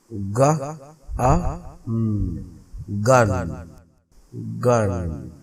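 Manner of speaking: गन split into ग, आ, न then joined; teacher sounding out गन; sounded out word. Shown as a demonstration. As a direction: spell गन by its sounds, then say it whole. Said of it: "teacher segmenting word गन".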